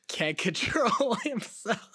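high, loud, laughing words; real laughter. Real, not acted. Host pointing out the engineer cracking up, real laughter. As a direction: laugh your way through it, drawn out.

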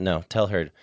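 ordinary talk

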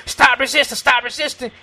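loud, high-pitched officer's command; mock police shouting. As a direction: call it out for the camera. Acted police shout for the camera.

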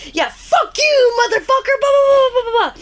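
jackass taunt. Retold or imitated. Fuller jeering at the trucker over the CB, high and mocking. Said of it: "being a jackass with the C B".

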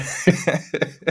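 high laugh. Real, not acted. Laughs at own joke.

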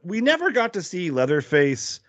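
usual speaking voice

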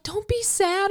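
high-pitched imitation of a sister; consoling sister. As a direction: squeak out a sympathetic plea. Voices sister consoling her crying.

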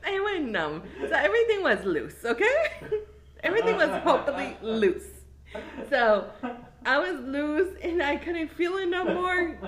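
laughing. Real, not acted. Laughing while telling embarrassing part.

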